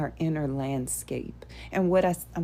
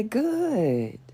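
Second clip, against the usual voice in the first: higher-pitched, stretched "good"; high, drawn-out good; 5 semitones higher than usual. Shown as a demonstration. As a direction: lift good high and let it glide down. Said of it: "You could say".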